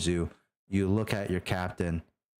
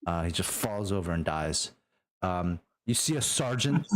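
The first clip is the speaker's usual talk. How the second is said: normal talking voice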